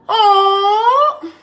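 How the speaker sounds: high, loud burst of delight; excited cry. Real, not acted. Reacting to a viewer's comment, excited cry. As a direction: yelp with real excitement.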